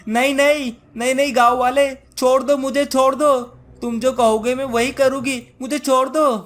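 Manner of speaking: high, loud panicked begging; frightened witch, pleading. Acted. Witch promising obedience to the villager, panicked pleading. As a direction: beg desperately to be let go.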